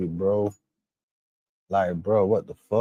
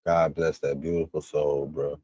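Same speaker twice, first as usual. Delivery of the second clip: softer, lower, solemn blessing; sincere mourning; 4 semitones lower, 4 dB quieter than usual. Real, not acted. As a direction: pay respects quietly. Mourning the murdered child.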